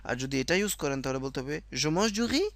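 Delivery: usual speaking voice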